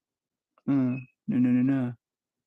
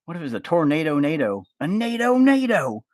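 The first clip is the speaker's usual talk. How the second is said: high, rising, slowed on nado nado; high goofy voice dragging out nado nado; mock excited idea; 7 semitones higher, 3 dB louder than usual. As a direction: pitch it up like a silly brainstorm. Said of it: "And then I was like".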